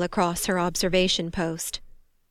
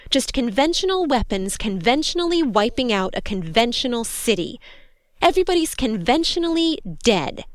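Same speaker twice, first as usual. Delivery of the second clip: higher, swooping sarcastic emphasis; bitter sarcasm; 4 semitones higher than usual. A narrator's reading. Soldier telling command the city is dead, bitter sarcasm. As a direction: lean on each "conventional" with exasperation.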